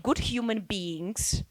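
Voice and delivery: ordinary delivery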